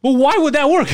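loud, high incredulous retort; yelling, going crazy. Retold or imitated. Retelling his answer to the confused coworker, yelling in exasperation. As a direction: re-enact the exasperated yell, pitched right up. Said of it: "I remember yelling, going crazy".

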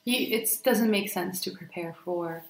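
ordinary talk, nothing marked

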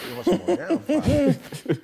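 high, loud, laughing disbelief; surprised laugh. Retold or imitated. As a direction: shriek out the question while cracking up. Retelling reaction to grandma, laughing.

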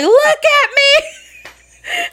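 high, drawn-out shouted order; frantic shout. Retold or imitated. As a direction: shout it slowly, imitating a desperate partner. Partner grabs face, frantic demand.

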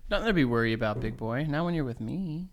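a low, soft, creepy come-on; creepy seductive voice. Pretend. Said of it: "your voice just now was, like, creepy as fuck".

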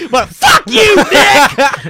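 loud, high, laughing yell at Nick; hammed-up outburst at a friend who hasn't seen the film; mock snob shout. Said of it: "You turned into one of those people".

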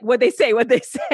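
high, loud mock eagerness; eager questioning. Actor to agent after audition, loud eager questioning. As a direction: pounce on it, laughing through the repeat.

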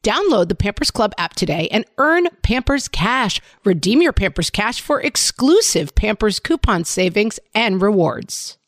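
upbeat sponsor read, loud and bright. Acted. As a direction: sell it like a radio ad.

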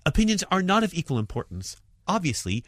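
normal talking voice

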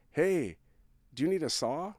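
imitating a man with a Spanish accent. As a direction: call it out with a hint of a Spanish accent. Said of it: "And in a thick, uh, Spanish accent".

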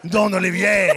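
high, loud hammed-up French astonishment; mock a Frenchman's loud amazed question; French store clerk. Sneaker store dude amazed by the American, hammed-up French voice. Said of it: "he's like".

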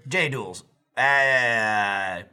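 botched robot reading, drawn-out ending; text-to-speech voice. Acted. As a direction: imitate a computer mangling the words. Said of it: "It would be like".

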